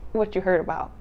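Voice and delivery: usual speaking voice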